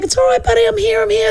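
calm a frightened pup, high and tender; high comforting baby talk; soothing a puppy. Retold or imitated. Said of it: "And she's like".

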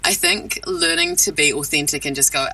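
usual speaking voice

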